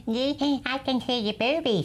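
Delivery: a hint of a little kid teasing; little child voice. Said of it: "a little voice from next door says".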